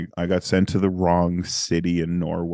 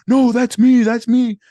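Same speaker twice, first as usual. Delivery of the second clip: much higher and louder, urgent; frantic high call of recognition; excited; 9 dB louder than usual. Retold or imitated. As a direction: jump in before the call drops. Urgently calling out on phone.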